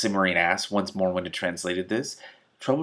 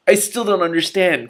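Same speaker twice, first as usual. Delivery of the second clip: complaining; 11 semitones higher, 10 dB louder than usual. A narrator's reading. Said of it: "Killer complained".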